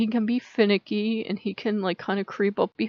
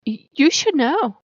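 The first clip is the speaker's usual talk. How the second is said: miffed; 6 semitones higher than usual. Retold or imitated. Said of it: "dealing with Loki is like dealing with a woman".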